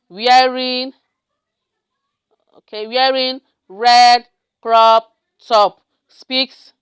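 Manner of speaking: drawn-out word-by-word dictation; level, held words with pauses between; slow dictation. Real, not acted. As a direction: stretch each word while typing it out. Dictating words while typing.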